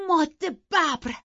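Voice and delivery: high, loud, slow outcry; shout. Acted. Catherine shouting at Isabella after being scratched, loud. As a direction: yell in pain and shock. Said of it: "خانم لینتون داد کشید".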